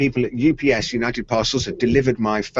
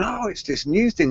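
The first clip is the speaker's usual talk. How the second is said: higher, casual imitation of a show-off; swanking wife; 8 semitones higher, 4 dB quieter than usual. Wife answering colleagues at the bank, showing off. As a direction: toss it off with mock pride. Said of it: "she's going".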